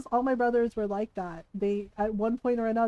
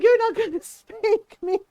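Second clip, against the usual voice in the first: high, louder taunt breaking into a laugh; 9 semitones higher, 5 dB louder than usual. Retold or imitated. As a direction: dare her while cracking up. Brother taunting mom, cracking up.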